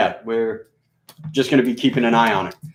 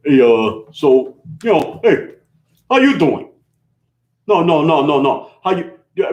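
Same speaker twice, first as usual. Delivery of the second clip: hammed-up New York City accent, pushed higher; bad New York accent; 6 semitones higher, 3 dB louder than usual. Shown as a demonstration. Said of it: "doing this really bad New York City accent".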